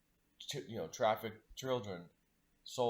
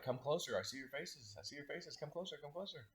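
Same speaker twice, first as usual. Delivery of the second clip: softer and higher, gently beckoning the little ones closer; soft beckoning call; 6 semitones higher, 6 dB quieter than usual. Retold or imitated. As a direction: coax them near like a hushed welcome. Calling to the children's souls in his mind.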